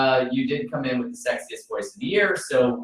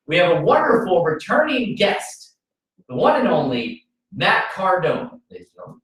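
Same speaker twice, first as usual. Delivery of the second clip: radio host intro; 4 semitones higher than usual. Acted. Radio show host opening.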